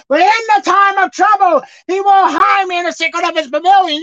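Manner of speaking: loud, high proclaiming of scripture; preaching at full force. Real, not acted. Preacher crying out scripture to the congregation, loud and high. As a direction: lift the verse up with full force.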